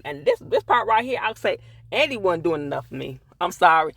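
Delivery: normal talking voice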